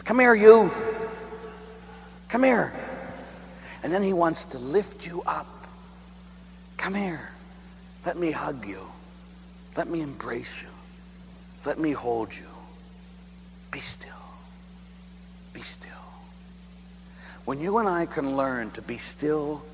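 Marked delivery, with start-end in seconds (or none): 0.0-2.7 put-on higher, affectionate call to a child; lightly voiced loving father calling his child; fond calling. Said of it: "He says". 6.8-15.9 soft, hushed and tender, dropping to a whisper; gentle soothing voice. Retold or imitated. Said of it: "He says".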